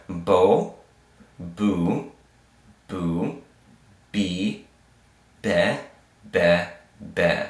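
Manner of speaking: "bo, boo, bee, be" one syllable at a time; held syllables with a pause after each; vowel drill. Shown as a demonstration. Teacher reviewing vowels with learners, drilled syllable by syllable. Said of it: "Let's review so far".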